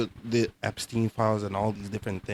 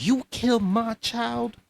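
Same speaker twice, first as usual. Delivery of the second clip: angry; 11 semitones higher, 4 dB louder, slower than usual. Real, not acted. Defending a murdered child's mother.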